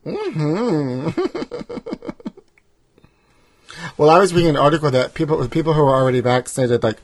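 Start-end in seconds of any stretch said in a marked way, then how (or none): 1.1-2.3 very high, soft breathy giggle. Real, not acted. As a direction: giggle at your own joke. Laughing after the bit.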